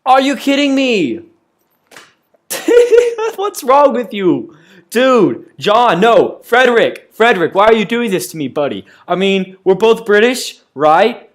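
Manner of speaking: loud, pleading outburst at the narrator; half-laughing, begging the game to stop; exasperated yelling. Real, not acted.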